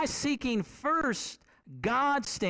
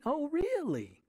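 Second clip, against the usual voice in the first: a lightly voiced mother, higher, teasing disbelief; mom's amused reply; 7 semitones higher, slower than usual. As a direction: play the mom humoring her boy. Mom answers boy in story.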